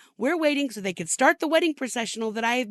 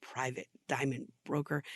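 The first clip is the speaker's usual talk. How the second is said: low, soft sneering repeat of Private diamond broker; scornful mutter; 8 semitones lower, 12 dB quieter than usual. Real, not acted. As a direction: mutter the words back in contempt. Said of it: "My ass".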